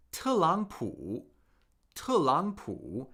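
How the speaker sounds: "Telangpu" slow, with each syllable's tone shown; careful teacher's model of Telangpu; Mandarin tones. Shown as a demonstration. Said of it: "This actually gets pronounced as".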